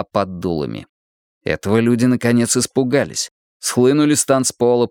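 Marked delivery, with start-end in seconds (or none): none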